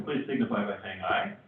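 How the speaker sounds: ordinary talk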